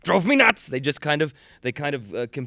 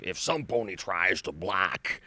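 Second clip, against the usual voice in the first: slow, lower character voice; Iron Will; 4 semitones lower, slower than usual. Acted. Actor recalling his pony character's big line, slow low voice. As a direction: intone a drawn-out motivational rhyme. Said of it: "what was the big one?".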